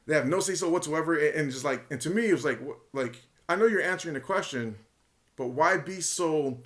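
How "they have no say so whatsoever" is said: mock emphatic denial; retold flat-out refusal, pressed firmly. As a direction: imitate a firm, aggressive answer. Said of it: "just deliberately emphatic".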